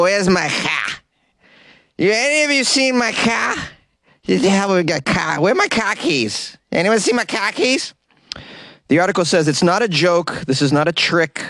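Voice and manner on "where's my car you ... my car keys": put-on slurred, high drunk Boston accent. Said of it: "slur a little bit with a very heavy Boston accent".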